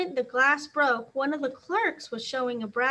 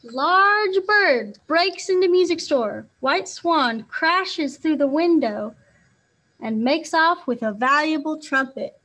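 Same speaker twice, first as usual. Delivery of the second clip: headline announcement; 2 semitones higher, 7 dB louder than usual. A narrator's reading. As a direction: boom it like a newsboy. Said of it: "The article was headed".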